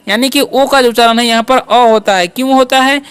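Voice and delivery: ordinary talk, nothing marked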